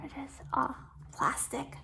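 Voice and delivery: unvoiced hushed whisper. Real, not acted. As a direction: whisper it close to the mic. Showing the braces close up.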